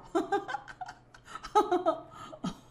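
high breathy burst of laughter; airy high giggle; guilty laugh. Real, not acted. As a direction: laugh it off, sheepish. Laughs after killing cow.

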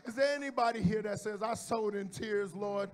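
loud, fervent preaching over music; full-force preaching. Acted. Preacher crying out the prayer to the congregation, fervent and loud.

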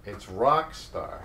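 slow, firm it's ROCK star; slow correction of the word rock; correcting stress. Shown as a demonstration. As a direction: set the lyric right slowly. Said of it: "No, it's not a non-star".

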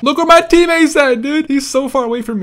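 high, loud complaint; exasperated shout. Real, not acted. Player seeing teammate far away, exasperated shouting. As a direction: shout it in disbelief.

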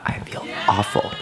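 airy, voiceless groan; breathy groan. Real, not acted. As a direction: breathe the words out, defeated. Groaning after eating too much.

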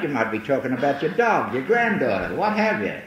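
ordinary talk

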